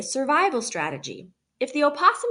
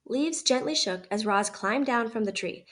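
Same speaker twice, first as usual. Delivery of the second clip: ordinary delivery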